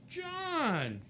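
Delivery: high, bright-voiced imitation of Wendy greeting him; Wendy's high greeting. Imitating Wendy greeting John warmly backstage, high. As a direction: chirp it up high like an excited hostess. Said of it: "She goes".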